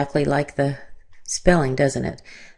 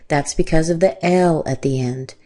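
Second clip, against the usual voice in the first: normal talking voice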